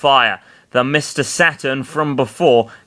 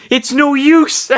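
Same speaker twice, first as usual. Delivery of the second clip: slow, shrieked high wail; high yell; 5 dB louder, slower than usual. A narrator's reading. Roy overcome by fiery curry, high yell. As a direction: cry it out loud and very high. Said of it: "Roy yelled".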